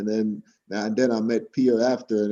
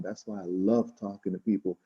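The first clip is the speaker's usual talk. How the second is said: ordinary delivery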